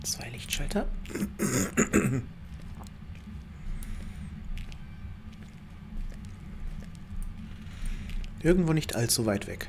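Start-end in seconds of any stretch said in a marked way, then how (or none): 0.9-8.4 throat clearing. Real, not acted. Throat clearing mid-play.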